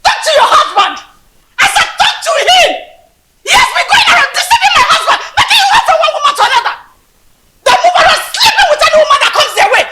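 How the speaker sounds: high, loud, rapid angry tirade; furious shouting. Acted. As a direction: confront her at a full yell. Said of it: "you need to calm down".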